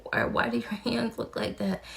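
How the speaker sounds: high-pitched retold disbelief; grossed-out question. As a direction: rise high asking "why do your hands look like that". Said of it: "And I was like".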